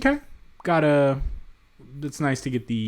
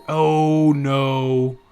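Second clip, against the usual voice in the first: drawn-out, loud "oh no"; loud dismay; 7 dB louder, much slower than usual. Real, not acted. Player spotting Poland next door, loud dismay. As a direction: groan it out loud in dread. Dismay at meeting a rival civ.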